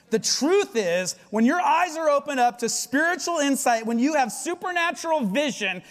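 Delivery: loud, high, building sermon peak; preaching at full force. Acted. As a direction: drive the truth home to the church. Preaching climax.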